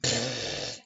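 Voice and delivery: low raspberry sound; imitated toot; fart noise. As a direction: blow a long low raspberry. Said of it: "He went".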